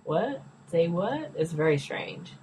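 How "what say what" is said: quiet, rising puzzled questions; mock bafflement. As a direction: act out doing a double take. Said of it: "It's like, what the hell?".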